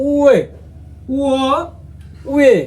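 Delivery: উয়ে and উয় glided from u; Korean vowels we and wo. Shown as a demonstration. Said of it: "কি হয়?".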